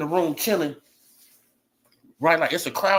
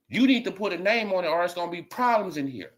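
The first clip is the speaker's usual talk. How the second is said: normal talking voice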